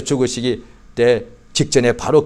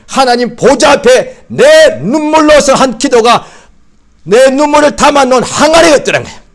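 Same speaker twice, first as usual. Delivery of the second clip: impassioned preaching; 9 semitones higher, 5 dB louder than usual. Real, not acted. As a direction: build to a peak about tears. Preaching climax on tears. (눈물의 기도를 높고 크게 외치는 절정)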